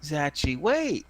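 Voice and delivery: put-on high-pitched little girl interrupting. Little girl tugging the leader's arm, high child voice. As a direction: call out high like an impatient small girl. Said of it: "the little girl kinda tugs him".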